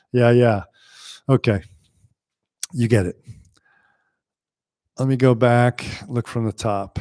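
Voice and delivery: normal talking voice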